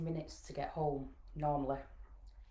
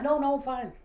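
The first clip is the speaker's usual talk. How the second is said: louder, high-pitched reassurance; acted insistent denial; 9 semitones higher, 8 dB louder than usual. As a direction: shut the question down with forced assurance. Repeats the lie to daughter.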